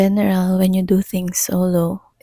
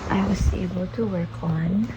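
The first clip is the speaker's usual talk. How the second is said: usual speaking voice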